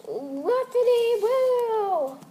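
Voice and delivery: very slow drawn-out whoopity woo. Acted. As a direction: stretch it out long and silly. Owner speaks dog.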